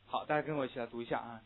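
ordinary talk

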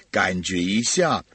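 usual speaking voice

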